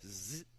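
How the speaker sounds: buzzed "zzz" zipper imitation; zipper noise. Friend unzipping the backpack in the back, imitated zipper noise. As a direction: make a zip sound with the voice.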